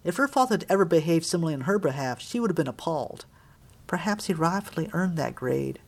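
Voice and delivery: ordinary delivery, nothing marked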